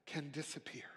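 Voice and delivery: a hushed whisper. Acted. Sermon, pastor whispering fear away. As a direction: let it vanish on the breath.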